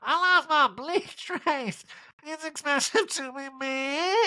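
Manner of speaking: very high, slow, breaking into laughs; Erica impression, laughing. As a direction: stretch it out through giggles. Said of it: "riffing the Erica voice".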